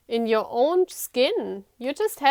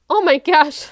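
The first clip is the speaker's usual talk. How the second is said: excited; 5 semitones higher, 10 dB louder than usual. Real, not acted. Reacting to the tarot cards.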